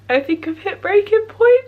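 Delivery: real crying, sobbing through words. Real, not acted. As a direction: voice cracking at breaking point. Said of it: "I'm gonna stop crying now".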